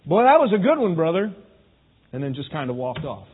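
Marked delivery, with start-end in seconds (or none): 0.1-1.3 loud, high, falsely hearty compliment; put-on condescending congratulations; mock sarcastic praise. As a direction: pretend to praise him, laying the sarcasm on. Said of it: "condescending way or a sarcastic way".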